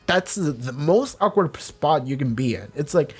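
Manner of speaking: normal talking voice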